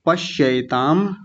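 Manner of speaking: पश्यैताम slow, with a clear ऐ; careful pronunciation. Shown as a demonstration. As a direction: sound out पश्यैताम. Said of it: "ऐसा बोलना है".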